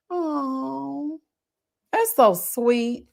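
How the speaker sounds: very slow, touched; tender. Real, not acted. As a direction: melt a little as you say it. Touched by reader's story.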